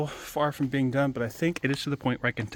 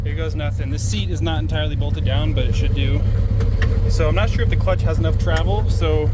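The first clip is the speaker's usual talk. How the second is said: raised voice over engine noise; shouting over engine; 3 semitones higher, 9 dB louder than usual. Real, not acted. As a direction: call out over the roaring motor. Yelling over a loud engine.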